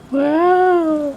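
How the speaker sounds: high drawn-out wow, like a game chime; wow sound effect. Pretend. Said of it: "Why did you say it like that?".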